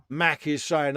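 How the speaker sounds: ordinary talk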